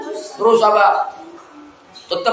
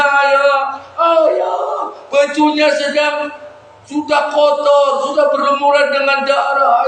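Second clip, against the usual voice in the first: high, chanting mock wail; fake crying; 8 semitones higher than usual. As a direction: plead with father in sham grief. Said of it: "sepuluhnya nangis".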